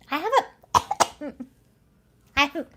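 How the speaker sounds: hoarse, throat-clearing voice; frog in throat. Real, not acted. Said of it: "a frog in my throat".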